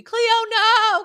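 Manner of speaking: high loud cry of no; shocked shout. Real, not acted. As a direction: yell at the movie screen. Reacting to Cleo getting shot.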